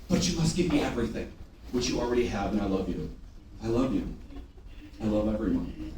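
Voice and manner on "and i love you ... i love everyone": low, soft declaration of love; quietly affectionate; tender aside. Real, not acted. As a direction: drop it low and softly reassure.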